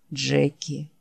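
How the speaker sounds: low, soft, worn-out creature voice; barely has the strength to say the name; weary dying Wolf. Acted. Wounded Wolf calling his friend Jack, low and faint. Wolf's voice.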